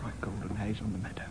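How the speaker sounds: whispered lyric, quick and hushed. Acted. Singing game, whispered song line. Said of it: "whispered song line".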